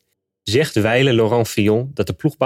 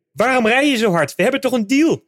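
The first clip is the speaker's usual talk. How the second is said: much higher-pitched cry of protest, little added volume; panicked shouting; 13 semitones higher than usual. Retold or imitated. Retelling a rival's protest during the race, high panicked cry. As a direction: imitate a rider's panicked outburst, pitched way up. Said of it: "schreeuwde hij".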